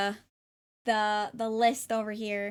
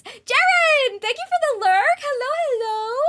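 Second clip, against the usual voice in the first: high, loud thank-you; excited greeting; 12 semitones higher, 8 dB louder than usual. Real, not acted. Streamer welcoming a lurker by name, excited. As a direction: call out the welcome far above usual pitch.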